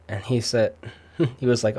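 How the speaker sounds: ordinary delivery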